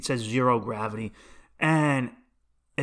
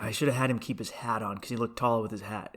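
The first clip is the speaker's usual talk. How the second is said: ordinary delivery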